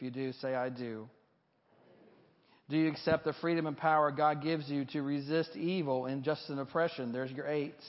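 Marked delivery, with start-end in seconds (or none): none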